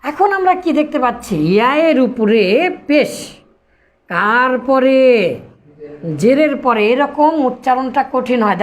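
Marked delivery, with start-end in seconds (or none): none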